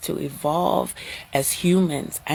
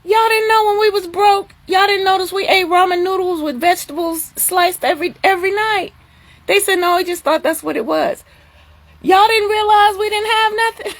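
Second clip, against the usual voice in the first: high, animated mock exasperation; pitched high and amazed at her sons; exasperated disbelief; 12 semitones higher than usual. Retelling her reply to sons.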